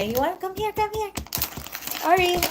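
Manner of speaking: calling a pet. Real, not acted. Calling the cat over.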